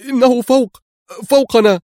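very high, loud cry of warning; alarmed scream. A narrator's reading. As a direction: yell it out in panic. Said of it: "فصرخ جولييت". (صراخ مذعور عالٍ)

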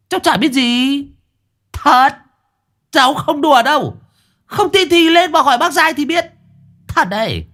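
put-on high, pleading little boy denying everything; child voice. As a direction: play the kid swearing he knows nothing. Boy protests innocence, high voice.

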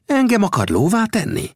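high-pitched indignant protest; indignant flare-up. Acted. Gibson protesting over the fire, indignant. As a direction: bristle and protest, voice pitched right up. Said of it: "fortyant fel Gibson".

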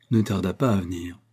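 ordinary delivery

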